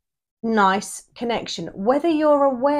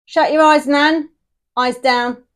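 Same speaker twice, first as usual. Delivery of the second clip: louder mock bingo call; bingo caller; 5 semitones higher, 7 dB louder than usual.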